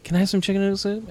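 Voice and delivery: a boy begging for his favourite soup, lightly played; eager kid. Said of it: "his face was like".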